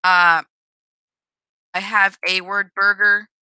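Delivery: stumbling, repeating words; stuttering. Real, not acted. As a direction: trip over the words. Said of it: "I'm stuttering".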